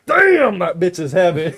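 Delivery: stunned at the weight, half laughing; mock strained surprise. Failing to lift the desk.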